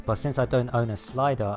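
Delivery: usual speaking voice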